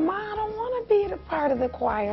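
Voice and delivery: put-on high, soft child's voice; little girl protesting. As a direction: imitate a small daughter's reluctant plea. Mother voices little daughter refusing.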